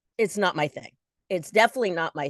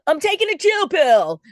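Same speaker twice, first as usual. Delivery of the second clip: playful exclaim; 9 semitones higher, 7 dB louder than usual. Pretend. Playful self quote.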